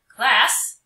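loud teacher's call to the room; calling the class. Acted. First grade teacher calls the class to attention, loud. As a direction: call out to get the class listening.

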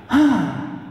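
long weary sigh through the mouth; big tired sigh. Acted. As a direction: let out a heavy tired sigh. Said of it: "he let go a big sigh".

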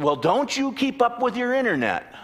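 excited congregant. Retold or imitated. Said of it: "He said".

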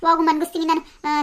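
ordinary delivery

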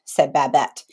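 plain reading voice

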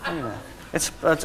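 ordinary talk

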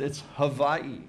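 Hawaii answered with a v for the w; v pronunciation. Retold or imitated. Local answering the newcomer's question, Hawaii pronounced with a v. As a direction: say Hawaii as "Ha-VAI-ee", matter-of-fact. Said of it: "He said, he says".

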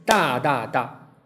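大大大 as three evenly spaced notes; three equal, measured syllables, 大, 大, 大; triplet rhythm. Shown as a demonstration. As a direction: sound out a slow triplet on 大大大. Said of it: "三连音就是".

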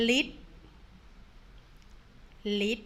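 ลิด said as lit, short vowel, falling; read aloud slowly. Shown as a demonstration. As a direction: model ลิด for students to repeat. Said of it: "อ่านตามคุณครูเลยก็ได้นะคะ". (อ่านออกเสียง ลิด ช้าๆ ชัดๆ)

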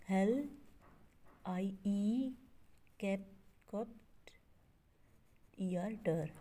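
long gaps between हेल, कप्ट and टर; slow broken-up sounding out from हेल to टर; syllable by syllable. Shown as a demonstration. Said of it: "इसके four syllables होंगे".

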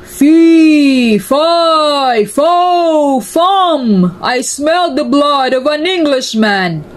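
the giant roars at Jack. A narrator's reading. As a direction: roar it like a furious giant. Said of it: "he roared".

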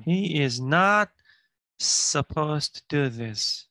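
very slow, every word clear; slow model reading. Shown as a demonstration. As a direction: read it word by word for learners. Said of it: "teacher reading the line slowly".

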